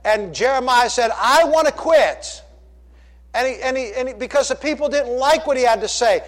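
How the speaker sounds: loud, high, emphatic sermon delivery; full-force preaching. Acted. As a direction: drive it home to the congregation.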